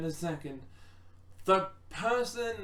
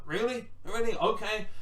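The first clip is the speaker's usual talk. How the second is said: incredulous Sami; 5 semitones higher, 4 dB louder than usual. Retold or imitated. Sami reacting to Knoxville's offer.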